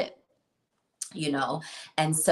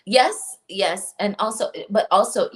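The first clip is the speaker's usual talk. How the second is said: higher, louder eager reply; excited; 5 semitones higher, 5 dB louder than usual. Real, not acted. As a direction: jump in with eager agreement.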